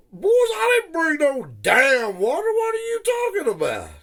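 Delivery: high, loud put-on voice, cracking up with indignation; mock outraged denial. The teller answering his crew in a high, hammed-up indignant voice.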